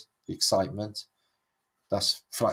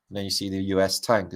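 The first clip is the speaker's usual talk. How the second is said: normal talking voice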